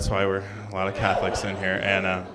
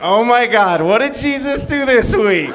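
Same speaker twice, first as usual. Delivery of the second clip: much higher, loud, almost shouting; gushing excitement; 13 semitones higher, 5 dB louder than usual. Acted. Churchgoer gushing about the latest church episode, excited and shrill. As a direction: squeal it like a superfan.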